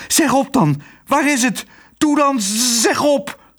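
high, frantic demands; worked-up, high-pitched insistence; stuttering with excitement. A narrator's reading. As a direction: demand it urgently, tripping on the last words. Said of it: "De burgemeester stotterde van opwinding".

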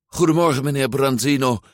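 high, hasty greeting; hurried. A narrator's reading. Luca greeting a farmer while chasing fish, hurried. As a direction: toss out a greeting in a rush. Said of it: "zei Luca gehaast".

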